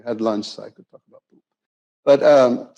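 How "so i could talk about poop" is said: whispered, conspiratorial joke to the room; a quick whispered confession; whispered aside. Real, not acted. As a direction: drop to a breathy whisper for the punchline.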